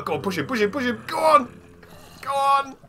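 urging on. Real, not acted. Player urging the mob to push, loud cheering. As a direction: egg them on like a fan.

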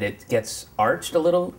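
ordinary talk, nothing marked

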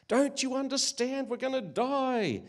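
high, loud desperate shout; panicked yell. Acted. Castaway berating his calm companion, high panicked yell. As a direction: plead in terror with someone who won't listen.

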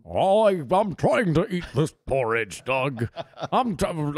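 a hint of old-school anchorman; Walter Cronkite impression. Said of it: "my Cronkite impression".

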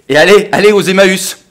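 high, very loud exasperation; outraged shout. Acted. Man seeing his jacket go to charity, outraged shout. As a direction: throw it out as a furious cry.

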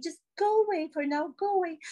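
high, singled-out staged plea; playful shooing. Acted. Actress sending darling away during interview, playful shooing. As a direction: shoo them off in a high voice.

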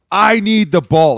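high, loud, drawn-out demand; acted demand. Player demanding the ball, loud acted demand. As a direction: slowly punch out a player's demand.

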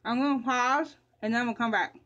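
toothbrush in foamy mouth; speaking around a toothbrush; talking while brushing teeth. Real, not acted. Seen 4: brush and foam in mouth throughout; gone by +2.9.

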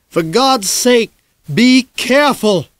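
loud, high, slow pleading holler; worried holler. Acted. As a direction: yell a warning after a boy on a bike. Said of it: "The last thing he hollered".

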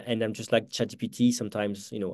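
ordinary talk

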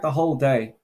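ordinary talk, nothing marked

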